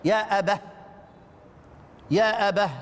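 showing students the ha stop. Shown as a demonstration. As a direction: end يا أبه on an h breath instead of a t. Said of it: "يقفان عليها بالهاء".

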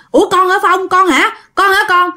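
high, eager repeated questions; acted father suddenly realising who it is; astonished recognition. Father asks whether this is his dead son, astonished.

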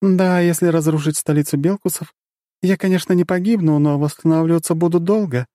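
high, sly goddess voice. A narrator's reading. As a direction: play the dark goddess, knowing. Goddess character voice continues.

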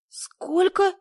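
very high, loud, incredulous question; disbelieving. A narrator's reading. Woman stunned by the distance, loud high disbelief. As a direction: shoot the pitch right up in disbelief. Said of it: "не поверила я".

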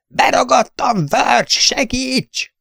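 high, slow, desperate cry for help; panicked scream. A narrator's reading. Trapped gunner screaming to Verge for help, panicked. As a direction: scream for help at the top of your lungs. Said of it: "Ruskell teli tüdőből ordított".

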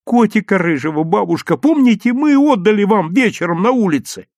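wheedling appeal; plaintive drawn-out plea. A narrator's reading. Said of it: "затянул Лёвка".

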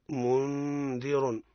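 منذر slowly, ن hidden before ذ with long ghunna; ikhfa example. Shown as a demonstration. Teacher demonstrating ikhfa on منذر, very slow. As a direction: draw out the nasal hold in منذر. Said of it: "مثال للذال".

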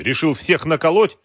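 loud, high-pitched angry questioning; angry accusation. A narrator's reading. Wolf accusing Galkin of cheating, angry. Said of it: "сказал со злостью".

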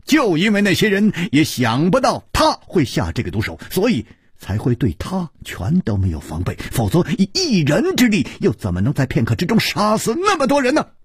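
high, forceful, driving delivery; urgent argument. A narrator's reading. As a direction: press the point home without a break. Detective presses his argument urgently. (语气急切，高声推理)

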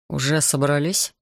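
ordinary delivery

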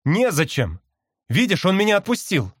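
cry back. A narrator's reading. Brother bird reassures his sister, crying back. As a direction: call back to talk her down. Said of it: "крикнул я в ответ".

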